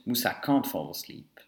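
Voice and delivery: ordinary delivery, nothing marked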